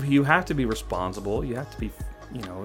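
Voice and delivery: ordinary talk, nothing marked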